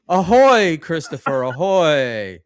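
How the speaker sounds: loud hammed-up ship's call; mock sailor hail. The host hailing a caller with a loud mock sailor's ahoy. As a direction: bellow it across the deck like a captain.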